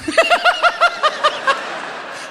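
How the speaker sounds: very high, helpless cackle; drugged giggle. Retold or imitated. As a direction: giggle as if doped up.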